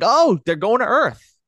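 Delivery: high, loud burst of recognition; excited realization. Retold or imitated. Recalling his first guess about the new ship, excited. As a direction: blurt it out like you just figured it out. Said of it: "and I was like".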